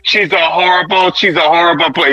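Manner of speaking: imitated sarcastic concession; mock sarcastic. Mimicking a caller on a bad player, sarcastic. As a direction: play him getting sarcastic, in his accent. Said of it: "He gets sarcastic".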